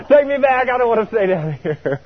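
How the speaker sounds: high, begging to be taken back; comic frightened plea; mock panic. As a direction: plead like a scared kid. Panicked plea facing the devil.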